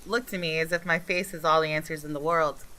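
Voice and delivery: normal talking voice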